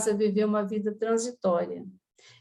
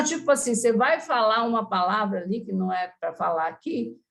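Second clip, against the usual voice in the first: normal talking voice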